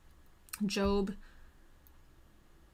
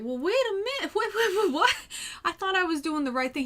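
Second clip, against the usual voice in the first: higher, with a laugh, flustered wait-what; laughing disbelief; 11 semitones higher, 6 dB louder, faster than usual. Retold or imitated. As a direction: caught off guard, laughing and stammering. Job startled by God's answer.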